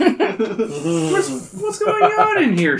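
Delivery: very high, loud, laughing mom voice; mom holding her nose. Acted. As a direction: pinch your nose and squeal it. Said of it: "she asks, holding her nose".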